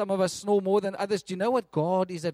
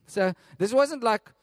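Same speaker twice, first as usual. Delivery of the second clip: normal talking voice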